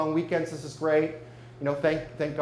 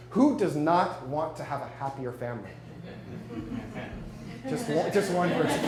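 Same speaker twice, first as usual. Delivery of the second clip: ordinary talk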